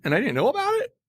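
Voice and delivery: normal talking voice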